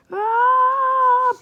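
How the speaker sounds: high, loud drawn-out "aah"; hammed-up scream of someone falling; mock falling scream. Reader acting out a pole jumper's fall, mock scream.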